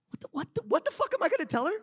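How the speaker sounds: high, fast flustered panic; panic, rushing words; panicked rush. Acted. Deadpool panics.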